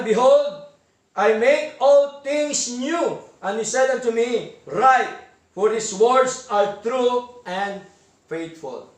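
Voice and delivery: slow, measured reading of the verse; slow, solemn sermon recitation; solemn scripture reading. Acted. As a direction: read it out word by word with weight. Reads scripture slowly, louder.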